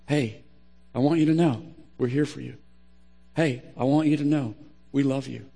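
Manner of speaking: soft, low reassurance; gentle support. Shown as a demonstration. Group member to someone who shared, tender reassurance. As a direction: reassure them quietly and sincerely. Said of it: "here's what you say".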